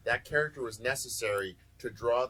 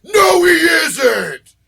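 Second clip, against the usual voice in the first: slow, much louder, higher shout; outraged shout; 8 semitones higher, 18 dB louder, much slower than usual. Retold or imitated. Scholar correcting his kids, outraged slow shout. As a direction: bellow it like a wounded fan. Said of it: "looks at him and goes".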